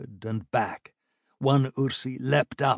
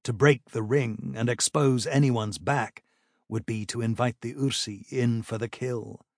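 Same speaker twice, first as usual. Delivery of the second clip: usual speaking voice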